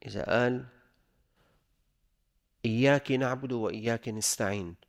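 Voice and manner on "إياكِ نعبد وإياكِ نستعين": إياك said "iyyaki", with i after the kaf; wrong vowel demo. Shown as a demonstration. Teacher showing a mistake in recitation, إياك said as "iyyaki". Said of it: "هذا خطأ يخل بالمعنى".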